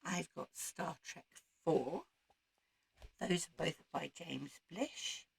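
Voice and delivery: ordinary talk, nothing marked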